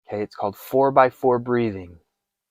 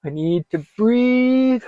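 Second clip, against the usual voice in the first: much higher, slow, close to crying; near tears; 13 semitones higher, 5 dB louder, slower than usual. Shown as a demonstration. Coach acting the panicking self, tearful and slow. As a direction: plead with yourself through tears. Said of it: "you're starting to cry".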